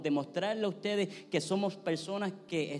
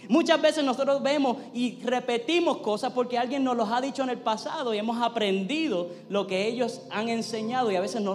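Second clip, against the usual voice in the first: higher, louder sermon voice, driving each phrase; rising sermon fire; full-force preaching; 7 semitones higher, 6 dB louder than usual. Acted. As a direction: preach it out to the congregation with conviction. Preaches the point loudly.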